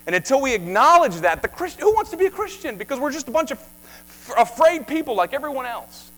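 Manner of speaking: high, loud, driving conviction; impassioned preaching. Acted. Preacher pleading with Christians to stop fearing, loud and high. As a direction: preach it with fire.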